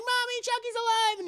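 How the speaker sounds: put-on high-pitched frightened child; put-on panicked little voice; Andy. Boy warns mommy Chucky's alive, high panicked cry.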